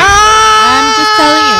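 mock scold. Cohost answers in mock voice.

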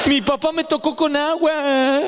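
agudo, lento y riendo de alegría infantil; chico emocionado. Retold or imitated. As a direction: como un nene feliz por una caricia mínima. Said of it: "Y nosotros, chocho". (los hermanos chochos porque el padre los mojó, agudo y riendo)

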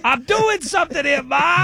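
high, slow shouted complaint; mock shout. Grown son yelling from the basement, mock shout. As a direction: yell up at Mom, annoyed.

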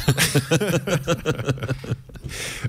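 breathless laugh. Real, not acted. Laughing after singing.

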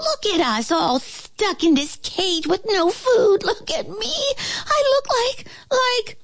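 high, whining little voice; exasperated flea. A narrator's reading. Flea laments cage and hunger, high exasperated whine. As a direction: wail it high, faltering for words.

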